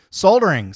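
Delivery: "soldering" high and loud, L sounded; emphatic British pronunciation. Shown as a demonstration. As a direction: punch "soldering" as "SOLE-dering". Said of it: "Sorry, Empire guys".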